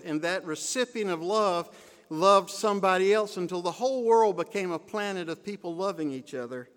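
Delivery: ordinary talk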